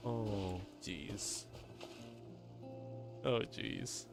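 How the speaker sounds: ordinary delivery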